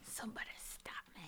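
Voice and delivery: soft, unvoiced whisper; quiet whispered movie line; whispered echo. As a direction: hiss it back as a whisper.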